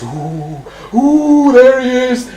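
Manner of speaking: hushed ooh rising to a high call; hammed-up eerie suspense; spooky bottler. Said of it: "the bottler goes".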